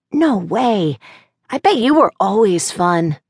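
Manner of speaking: put-on high, admiring little boy; child voice. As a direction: say it like an adoring kid. Child talks to his aunt.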